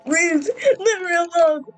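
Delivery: high, whiny plea to be left alone; hammed-up fearful cry; scared pleading. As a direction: beg like a frightened character. Game character begging.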